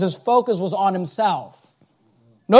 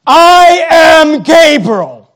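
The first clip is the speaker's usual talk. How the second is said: slow, higher, louder angelic proclamation; booming angel; 6 semitones higher, 8 dB louder, much slower than usual. Acted. Gabriel declaring himself to Zacharias, booming slowly. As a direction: announce yourself grandly and slowly. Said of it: "I think that's how he said it".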